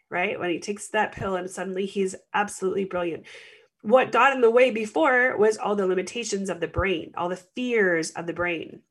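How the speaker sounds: ordinary talk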